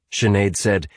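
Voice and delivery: ordinary delivery, nothing marked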